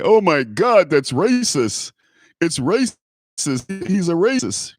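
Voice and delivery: high, hammed-up shocked accusation; mock outrage. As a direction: play the offended crowd, half mocking.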